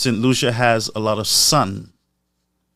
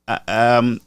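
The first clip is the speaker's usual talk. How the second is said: ordinary delivery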